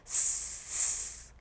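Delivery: long hiss; snake hiss. Shown as a demonstration. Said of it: "We say that as a hiss".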